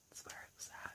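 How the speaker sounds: breathy whisper of admiration; secret-sharing whisper; whispered aside. A narrator's reading. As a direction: lean in and whisper it. Hushed aside praising Hoot.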